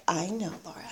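quietly, as if she already knows; low and soft retold reply; hushed knowing reply. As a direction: imitate her soft, low, knowing answer.

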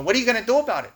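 mimicked no-nonsense tough guy; Tomas impression. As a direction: press him like a tough sponsor. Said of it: "That's how he talks".

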